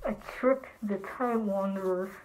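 low, hushed villain monologue; scheming villain. Acted. Villain gloating over tricking the Time Wanderer, low and soft.

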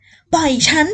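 high, loud struggling cries; frantic yelling. Acted. Captive woman screaming at the man to let her go, high frantic yell. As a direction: yell to be let go while thrashing. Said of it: "ฝันโวยวาย".

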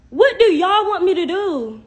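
loud, high, drawn-out demand; exasperated outburst. Real, not acted. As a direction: throw it at them, slow and exasperated. Exasperated plea to critical viewers.

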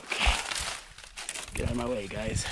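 normal talking voice

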